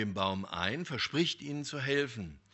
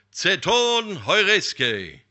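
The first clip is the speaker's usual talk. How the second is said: loud, slow, higher proclamation; solemn blessing; 7 semitones higher, 13 dB louder, much slower than usual. Acted. God blesses the young man with a loud, slow solemn pronouncement. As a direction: pronounce it like a divine decree.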